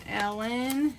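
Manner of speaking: normal talking voice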